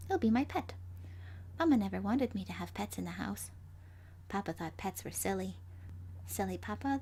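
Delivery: ordinary delivery, nothing marked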